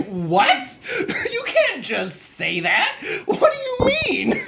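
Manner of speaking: high, loud disbelief through laughter; incredulous outburst. Retold or imitated. Fire guard answering the recruit's creepy remark, incredulous laughing outburst. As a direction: retell it as a laughing, baffled shout. Acting out his alarmed reply, laughing.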